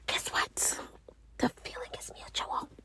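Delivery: very soft, whispered comeback; mock whisper. Mother telling son she dislikes him back, playful whisper. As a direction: whisper it like a cheeky secret.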